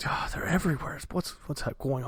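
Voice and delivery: breathless scared whisper; frightened whisper. Acted. Townsfolk whispering about the goblin kidnappings, scared. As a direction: hush it like spreading a terrible rumour.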